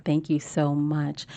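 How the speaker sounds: ordinary delivery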